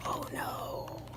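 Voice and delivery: breathy whisper of dismay. Acted. Narrator whispering alarm at the trouble. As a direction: whisper it, worried.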